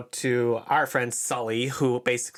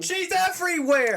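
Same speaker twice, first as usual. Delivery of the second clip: exasperated. Real, not acted. Complaining about [name].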